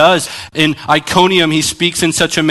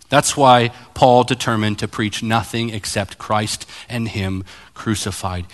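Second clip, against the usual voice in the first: normal talking voice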